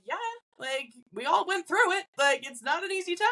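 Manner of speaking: high, gentle reassurance; reassuring. Retold or imitated. Medium reassuring parents about their teenager, high and gentle.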